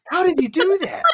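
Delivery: high, astonished question; amazed disbelief. Retold or imitated. Asking the Scottish manager, in high mock amazement. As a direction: marvel at the impossible, way up high. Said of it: "And I'm just like".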